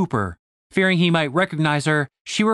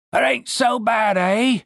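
higher rough soldier voice; nervous soldier grinning; 7 semitones higher, slower than usual. Acted. Yellowteeth voiced.

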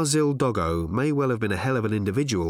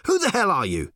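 high, indignant challenge; demanding; 9 semitones higher, 3 dB louder than usual. A narrator's reading. Reporter confronts a stranger behind the desk, demanding. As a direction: demand it, pitched well up. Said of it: "he demanded".

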